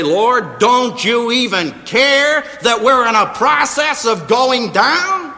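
loud, high panicked plea; panicked disciples. Retold or imitated. Frightened disciples shouting to the sleeping Lord in panic. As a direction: cry out to the Lord as the boat sinks. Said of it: "to translate it graphically".

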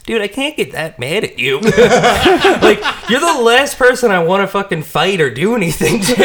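high voice breaking into laughter; helpless giggly protest; laughing protest. Retold or imitated. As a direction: give in, laughing too hard to be mad. Laughing while quoting himself to Mikey.

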